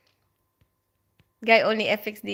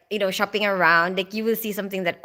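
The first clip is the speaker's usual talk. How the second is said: ordinary delivery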